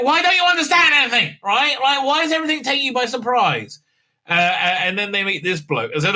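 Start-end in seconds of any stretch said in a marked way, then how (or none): 0.0-3.0 exasperated. Real, not acted. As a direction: throw up your hands at her. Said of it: "exasperated at the Doctor".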